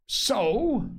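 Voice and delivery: high-pitched exclamation; crying out. A narrator's reading. Silver hears of a buccaneer inside, crying out. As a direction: exclaim it in surprise. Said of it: "cried Silver".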